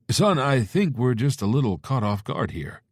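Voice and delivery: caught-off-guard dad. Acted. Father voiced on phone.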